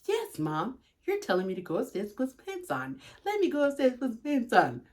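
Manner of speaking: soft, dutiful imitation of a daughter; mock-obedient, quiet recital; imagined obedient child. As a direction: play the compliant kid, softly. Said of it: "And then you would have said".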